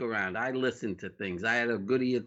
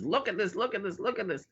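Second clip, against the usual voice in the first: high, eager look at this; excited urging; 9 semitones higher, faster than usual. Retold or imitated. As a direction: push the chart at him, thrilled. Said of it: "he's flinging all this, the flares".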